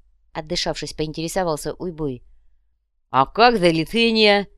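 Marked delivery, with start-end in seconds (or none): 3.1-4.5 loud, slow surprised question. A narrator's reading. Одноглазый questions Копыта, surprised. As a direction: ask in drawn-out astonishment. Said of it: "удивился Одноглазый".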